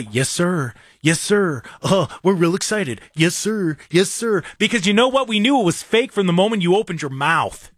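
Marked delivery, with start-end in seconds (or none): 0.0-4.4 lightly mocking a polite player saying yes sir; mock yes-man. Mocking the quarterback's fake politeness, lightly voiced. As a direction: imitate his hollow yes sir routine. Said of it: "you don't do the".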